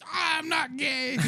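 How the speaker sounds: high slow mock protest; mock defensive. Caught in stairwell, mock high protest. Own reply acted in story.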